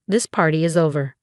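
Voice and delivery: ordinary delivery, nothing marked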